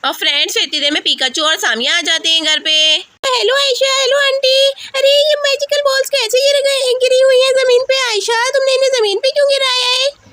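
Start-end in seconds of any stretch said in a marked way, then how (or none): 3.3-10.3 put-on high, perky child voice; greet, then question in childish surprise; high-pitched puzzled visitor; surprised child friend.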